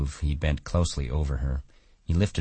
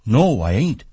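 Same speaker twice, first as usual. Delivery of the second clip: louder, higher earnest denial; 7 semitones higher, 7 dB louder than usual. A narrator's reading. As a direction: insist like an earnest, childlike man. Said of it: "Lenny explained earnestly".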